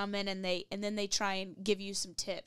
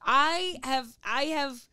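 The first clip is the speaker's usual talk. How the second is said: ordinary talk, nothing marked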